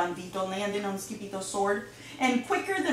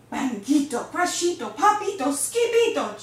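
loud words climbing ever higher; escalating excitement; 5 semitones higher, 7 dB louder than usual. A narrator's reading. Narrator voices the crashes in the kitty boy's room, building excitedly. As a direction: step the pitch up with each word to a peak.

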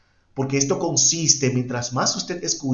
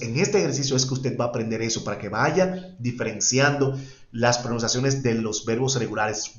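ordinary delivery

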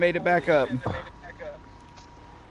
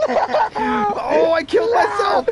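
high-pitched cry of dismay; panicked outcry; 3 dB louder, slower than usual. Real, not acted. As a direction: wail it in real alarm. Realizes he wrecked his own truck.

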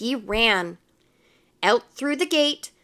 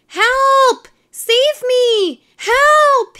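high, loud panicked yell; long, high storybook screams; crying for help; 13 semitones higher, 10 dB louder, much slower than usual. A narrator's reading. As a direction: shout for rescue from a treetop. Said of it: "he cried".

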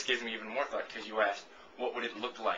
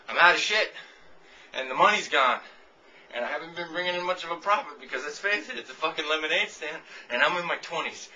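loud, higher complaining self, fading as it goes; mock broke stand owner; 5 semitones higher, 7 dB louder than usual. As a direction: whine it like a broke lemonade seller. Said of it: "I'll just be like".

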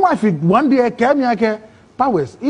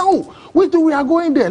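eager offer; 9 semitones higher than usual. Retold or imitated. Men offer to share a taxi.

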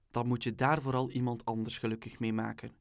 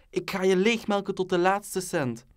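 raised, higher and louder, as an enraged mama; mama's angry shout; 7 semitones higher, 6 dB louder than usual. A narrator's reading. Mama storming through the house at papa, shouting. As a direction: shout it like a furious wife threatening her husband. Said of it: "rare dingen schreeuwt".

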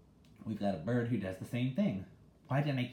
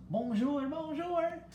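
very high squawky bird bonjour; mynah bird voice; 4 dB louder, much slower than usual. Acted. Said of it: "in bird voice".

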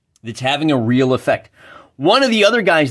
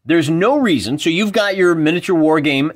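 normal talking voice

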